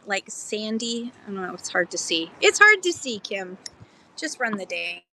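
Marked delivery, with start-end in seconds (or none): 2.4-3.2 louder, higher call. Real, not acted. Repeats louder for Kim.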